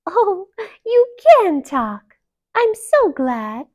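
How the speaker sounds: high, loud, slow delight; gaily. A narrator's reading. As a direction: bubble over with gladness. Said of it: "continued gaily".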